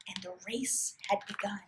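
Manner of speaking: hushed whisper of suspense. A narrator's reading. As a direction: breathe it out in a whisper. Whispered narration.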